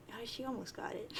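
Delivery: ordinary delivery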